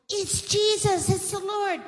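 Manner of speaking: high, loud, drawn-out exclamation; excited cry. Retold or imitated. John spots Jesus from the boat, crying out excitedly. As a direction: cry out in amazed recognition.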